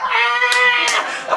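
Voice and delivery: shrill mock scream of pain; high kid scream. Boy yelling while his mama hits him, high scream.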